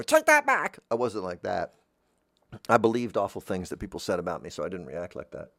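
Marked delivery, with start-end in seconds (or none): 0.0-0.8 loud high indignant demand; thin-skinned demand. Retold or imitated. Thin-skinned person answering an insult, loud high indignant. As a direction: snap it out loud and much higher. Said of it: "you know".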